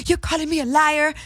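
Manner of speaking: high mock outrage; indignant clerk. Imitating a shop clerk accused of lying, mock indignant. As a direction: shoot up in pitch, mimicking her offense.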